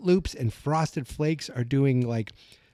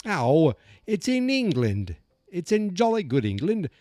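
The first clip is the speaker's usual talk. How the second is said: slow, hammed-up jolly English accent; cartoonish English accent; mock English accent; 5 semitones higher than usual. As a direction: put on a jolly-good English accent, pitched up. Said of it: "what am I doing speaking in an American accent".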